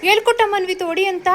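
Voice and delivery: loud, high demand for an answer; shouted questioning. Real, not acted. Mother questions children from afar.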